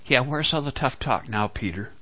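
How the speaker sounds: usual speaking voice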